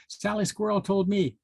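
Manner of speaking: high piping marmot voice; piped. A narrator's reading. Marmot reports to the mountain monarch, high and piping. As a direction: pipe it up high like a small marmot. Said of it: "piped Mandy Marmot".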